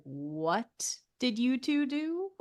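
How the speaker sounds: drawn-out, appalled "what"; mock disbelief, stretched out; slow stunned question. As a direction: ask it very slowly, dumbfounded. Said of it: "turning around and being like".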